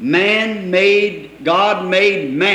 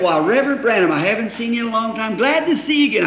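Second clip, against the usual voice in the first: wife's greeting, higher; 6 semitones higher, faster than usual. Retold or imitated. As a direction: chirp it out like the pleased wife. Wife greets preacher sweetly.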